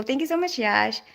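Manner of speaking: usual speaking voice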